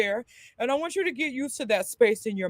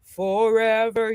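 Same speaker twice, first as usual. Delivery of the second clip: slow yawning word; yawned speech; slower than usual. Shown as a demonstration. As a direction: yawn it slowly.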